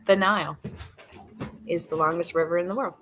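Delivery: ordinary talk, nothing marked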